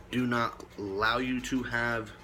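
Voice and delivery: ordinary delivery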